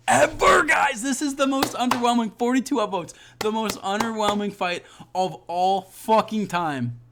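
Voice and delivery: high, loud outburst of disbelief; exasperated shout. Real, not acted. Fan ranting to viewers about an underwhelming fight, exasperated shouting. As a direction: shout it high, then drag out the ending.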